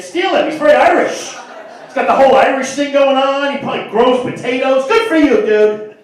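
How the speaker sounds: high, loud crowd-work heckle; loud teasing. Real, not acted. Comic yelling at audience member.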